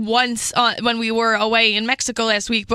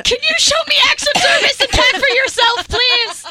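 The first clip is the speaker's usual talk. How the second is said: squeaky mock plea, higher and louder; high mock pleading; 12 semitones higher, 4 dB louder than usual. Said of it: "you're like".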